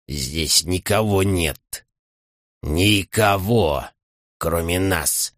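slow, low and wistful; lost, aching longing. Acted. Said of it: "В словах Шрюда была тоска".

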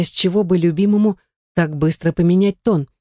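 ordinary delivery

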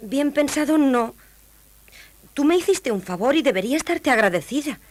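high, scolding princess; haughty princess. A narrator's reading. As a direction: lecture the frog in a high voice. Princess answers frog. (princesa altiva con voz aguda)

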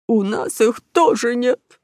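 high, slow, woeful crook; sorry robber's plaintive voice; sad. Acted. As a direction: draw it out mournfully. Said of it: "ответил грустно усатый Марио".

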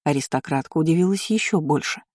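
ordinary delivery, nothing marked

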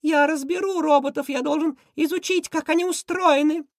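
very high, loud order easing into eager plan; high cartoon villain giving commands; shrill commanding Krysion. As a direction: shriek the order, then explain excitedly. Villain orders capture of the robots.

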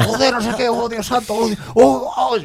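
high hammed-up cries of panic; mock panic. Gamer imitating NPCs panicking as the plane attacks. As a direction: wail oh God in fake terror.

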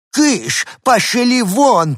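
put-on old woman shooing them away; shooing shout. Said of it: "топала ногами старушка".

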